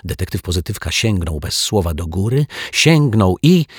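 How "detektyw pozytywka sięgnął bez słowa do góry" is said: ordinary delivery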